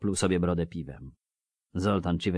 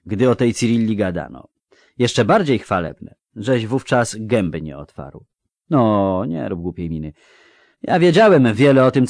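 knowing dwarf officer; 4 semitones higher, 8 dB louder than usual. Acted. Voices dwarf Denis confiding.

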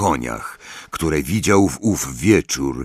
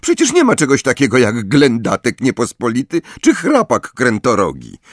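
higher-pitched dismissive scoff; scornful snort; 5 semitones higher, 4 dB louder than usual. A narrator's reading. Hermione debunking Luna's odd creatures, scornful. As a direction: dismiss it as nonsense. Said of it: "prychnęła Hermiona".

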